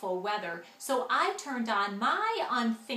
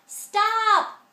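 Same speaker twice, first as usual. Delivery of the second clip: loud, high shout of stop; yell; 10 semitones higher, 11 dB louder than usual. A narrator's reading. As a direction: cut him off with a sharp yell. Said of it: "yelled my sister".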